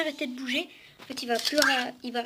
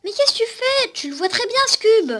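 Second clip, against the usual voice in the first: startled exclamation; 10 semitones higher, 9 dB louder than usual. Real, not acted. Robot misbehaving with the cube.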